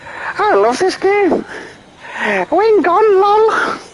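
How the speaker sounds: very high, slow squeaky voice; put-on squeaky Irish accent; squeaky accent impression. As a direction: push it right up into a squeak. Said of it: "it's the squeakiness I'm going for".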